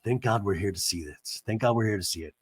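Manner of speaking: low, soft sentimental murmur; hushed mock tenderness; husband at the sunset, low soft mock sincerity; lightly voiced Terry. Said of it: "Terry's like".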